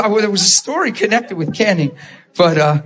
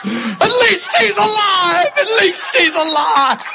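high, slow, tearful mock holler; wailing kid; 13 semitones higher, slower than usual. Boy running laps for coach, hammed-up tearful wail. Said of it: "And this kid goes".

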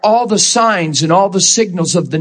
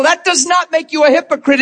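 ordinary delivery

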